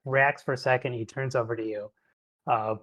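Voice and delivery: normal talking voice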